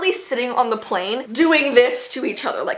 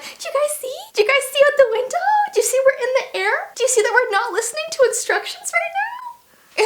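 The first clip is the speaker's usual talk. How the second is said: very high, mocking caricature voice; mocking oblivious passengers; 14 semitones higher than usual. Passengers showing off the window to FaceTime friends, mocking high voice. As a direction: imitate passengers in a squeaky high-pitched mock voice.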